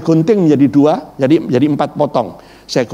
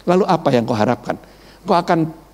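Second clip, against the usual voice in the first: normal talking voice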